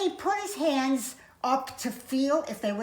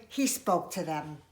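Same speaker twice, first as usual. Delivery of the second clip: ordinary delivery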